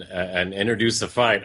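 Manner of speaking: ordinary talk, nothing marked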